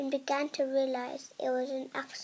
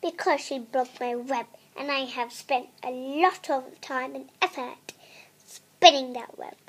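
a touch of indignation, not much louder; mildly angry spider; 8 dB louder than usual. Acted. Spider complaining to the princess, mildly angry. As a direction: grumble it like a put-upon spider. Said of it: "said the spider in a very angry voice".

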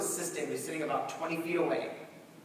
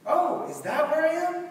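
much higher, put-on goofy voice; goofy joke; 12 semitones higher, 6 dB louder than usual. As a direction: land a silly joke in a goofy voice. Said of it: "And I'm like".

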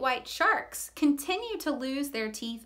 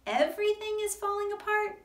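higher, fast puzzled question; echoing surprise; 7 semitones higher, faster than usual. Acted. Friend echoing Sakina's words in surprise, higher. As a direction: repeat it back, puzzled. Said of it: "Remy echoed".

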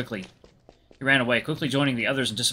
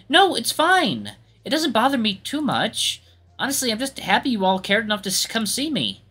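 reassuring musician; 10 semitones higher, 3 dB louder than usual. Acted. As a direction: put friends at ease in a higher character voice. Voices musician reassuring friends.

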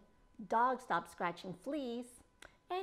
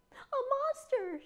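high, drawn-out bird shriek; story myna's shrill alarm; 13 semitones higher, 5 dB louder, slower than usual. A narrator's reading. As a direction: shriek it in alarm, loud and slow. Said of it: "shrieked the myna".

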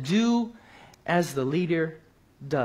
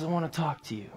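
much softer, imitated confiding voice; subdued plea; 8 dB quieter, faster than usual. Big man face to face with Bible worker, retold softly.

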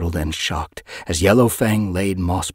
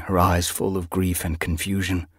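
plain reading voice

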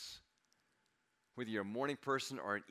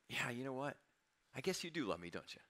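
tender realisation; 3 semitones higher, 5 dB quieter than usual. Retold or imitated. Laughing realisation, speaking to God.

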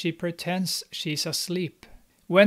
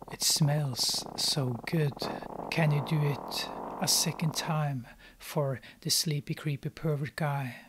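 creepy pervert voice; 3 semitones lower, 7 dB quieter than usual. Acted. Creepy guy relishing the smell, voiced in a soft creeping whisper. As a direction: savor it like a sleazy lurker, trailing into a whisper.

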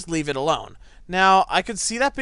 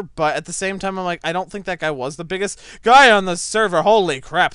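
usual speaking voice

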